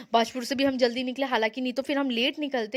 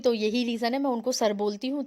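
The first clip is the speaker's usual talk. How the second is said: ordinary delivery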